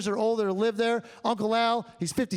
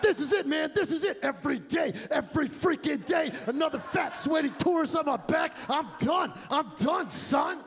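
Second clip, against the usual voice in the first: high, strained rant building to a shout; a fed-up mule snapping at last; mule breakdown; 5 semitones higher than usual. Retold or imitated. Mule ranting at the tourists, in a high strained shout. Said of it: "He's like".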